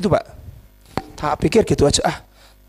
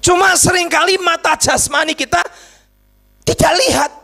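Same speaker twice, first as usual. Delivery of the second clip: high, insistent preacher's voice; forceful preaching; 9 semitones higher, 3 dB louder than usual. Real, not acted. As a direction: drive it home to the congregation.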